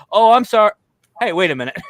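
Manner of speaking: flustered, then catching on to the joke; caught-out apology. Retold or imitated. Mason answering the prank yell, startled then realising.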